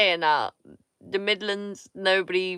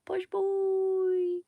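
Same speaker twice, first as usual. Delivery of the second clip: high mock posh; 12 semitones higher, 3 dB quieter than usual. Teasing posh boy again.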